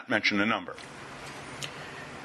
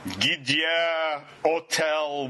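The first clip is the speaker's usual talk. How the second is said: put-on Gdye Hotel drawn out very slowly for a child; slow pidgin Russian; much slower than usual. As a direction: spell it out slowly in broken Russian. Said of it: "So I say to a little kid".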